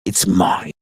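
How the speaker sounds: slow, lightly voiced soul-stealing villain; menacing villain voice. Lover mocked as villain, slow and lightly sinister. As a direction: drawl it like a sinister demon.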